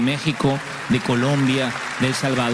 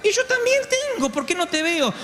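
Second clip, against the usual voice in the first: put-on old mother's voice. Mother insists.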